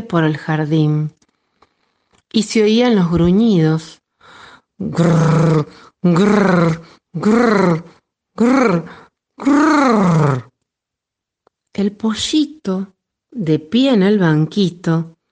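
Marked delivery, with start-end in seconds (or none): 4.8-10.5 rolled growl sounds; giant growling. A narrator's reading.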